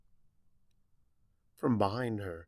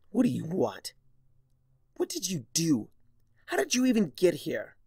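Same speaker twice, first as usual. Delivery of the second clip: rising, higher through clenched teeth; gritted teeth; 7 semitones higher, 4 dB louder than usual. Acted. Starlight demanding answers from Discord, through gritted teeth. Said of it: "Starlight gritted her teeth".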